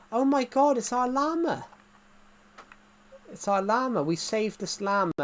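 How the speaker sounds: high, thrilled cry of discovery; excited reenactment. Retold or imitated. Player reliving the llama rescue for viewers, excited reenactment.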